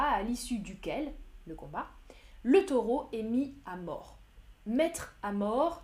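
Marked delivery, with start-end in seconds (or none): 4.7-5.8 slow teaching. Shown as a demonstration. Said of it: "ça veut dire tuer".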